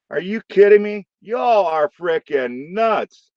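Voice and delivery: high incredulous, then slow; amazed that anyone is watching; disbelief. Real, not acted. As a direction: pitch up in disbelief, drag the last line. Incredulous at viewer count.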